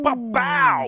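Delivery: ordinary talk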